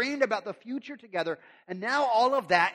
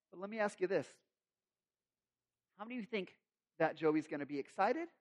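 ordinary delivery

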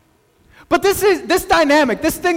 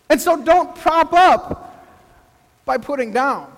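slow, weighty maxim with pauses; slow emphatic maxim; 3 semitones higher, slower than usual. Shown as a demonstration. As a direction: lay down the rule one step at a time. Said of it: "preacher states the rule slowly".

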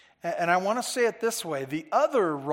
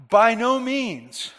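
adamant; 4 semitones higher, 8 dB louder, slower than usual. Shown as a demonstration. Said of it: "awkward way of saying it".

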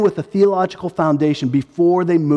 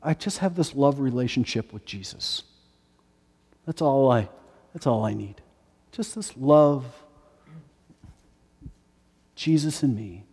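mocking sweetness; 5 semitones lower, 8 dB quieter than usual. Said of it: "those claiming to be evangelical Christians".

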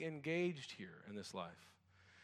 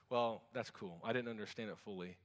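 ordinary talk

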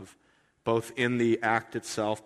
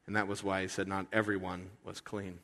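normal talking voice

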